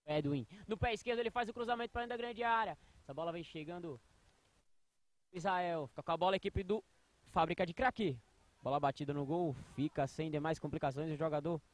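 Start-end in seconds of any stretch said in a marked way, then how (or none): none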